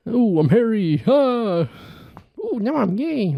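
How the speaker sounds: very high put-on squeal of a voice; Robin Williams voice. Podcaster doing Robin Williams as a monkey, very high and squeaky. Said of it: "That's Robin Williams in a heartbeat".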